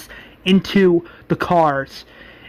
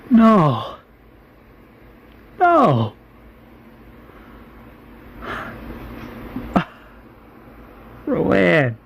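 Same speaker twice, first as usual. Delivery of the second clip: shocked grief; 2 semitones higher, much slower than usual. Retold or imitated. Milton reacts to Rowan's killing.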